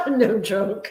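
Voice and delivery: laughing as he echoes it; amused echo, laughing on the words. Real, not acted. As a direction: repeat it through a laugh. Echoing the punchline, amused.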